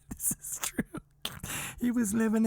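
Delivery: breathless whispered laugh. Real, not acted. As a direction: barely get it out through laughter. Teller laughing at tent story.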